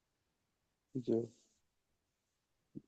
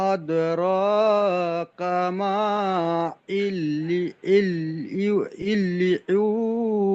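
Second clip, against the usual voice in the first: Quran recitation; much slower than usual. Real, not acted. Student recites again.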